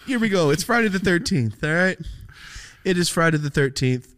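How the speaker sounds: high, loud hype about Friday the thirteenth; excited announcement. Real, not acted. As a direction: announce it like big news.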